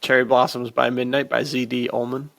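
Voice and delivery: usual speaking voice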